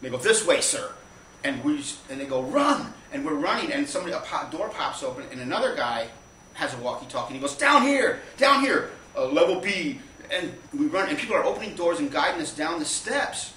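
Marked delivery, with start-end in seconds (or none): 0.2-0.9 loud, brisk directive; mock airport staff, projecting loudly; official directing passengers. Airport staff steering late passengers to the plane, loud and official. 2.4-2.9 high, loud yell; urgent shouted order. Retold or imitated. Airport staff hurrying late passengers, urgent yell. 7.6-10.0 high, loud calling out; urgent shouted directions. Retold or imitated. Walkie-talkie guy guiding running passengers, urgent shout.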